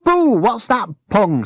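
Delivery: high, bright rag doll voice; squeaky little rag doll asking; Jim's voice. Acted. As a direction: pitch Jim up high and curious. Narrator voices Jim.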